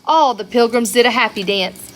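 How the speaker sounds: ordinary delivery, nothing marked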